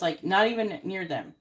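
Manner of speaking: normal talking voice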